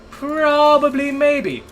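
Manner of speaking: high, stretched-out words; slow, high comic maybe; drawn-out hedging. Real, not acted. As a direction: stretch it loud and high, playfully unsure. Answers own doubt about voice.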